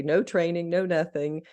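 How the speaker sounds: usual speaking voice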